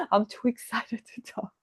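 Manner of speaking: soft laughing, voice giving way; real laugh breaking up a quiet apology; too excited to talk. Real, not acted. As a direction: laugh softly through the words. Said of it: "sorry, just my voice is like".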